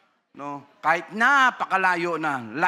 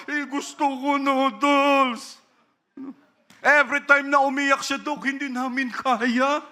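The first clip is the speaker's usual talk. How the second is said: much higher, slow, pleading parent; whining parent; 11 semitones higher, 3 dB louder than usual. Retold or imitated. Parent excusing themselves to the doctor, high whining plea. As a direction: whimper the excuse like a guilty parent.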